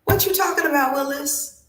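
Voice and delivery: high-pitched eighties sitcom catchphrase; comic bewilderment. Retold or imitated. Student baffled by the teacher, quoting an eighties sitcom in comic bewilderment. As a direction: squeal it in hammed-up disbelief. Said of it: "I was scratching my head like".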